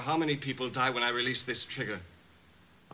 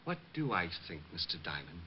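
much softer and lower, contemptuous; cold, low menace; 8 semitones lower, 13 dB quieter than usual. Acted. Bomber sneering at the detective, soft and low.

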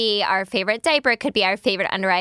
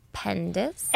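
much softer, rising "Pendus"; sneaky afterthought; 8 dB quieter than usual. Retold or imitated. Mother imitates son finishing his insult late, soft rising "Pendus". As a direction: slip "Pendus" in quietly, with a lift at the end. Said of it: "count to, like, five. And he goes".

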